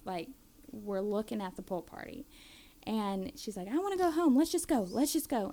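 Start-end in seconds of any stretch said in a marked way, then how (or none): 3.7-5.5 high, louder begging; pleading kid. Retold or imitated. Mom voicing daughter outside the party, high pleading. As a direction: beg to go home like a scared child.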